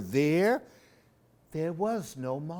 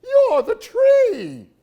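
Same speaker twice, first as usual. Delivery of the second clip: loud, much higher, slow shout; frightened yell; 13 dB louder, slower than usual. Acted. Albert yells at the owl in shock, loud and high.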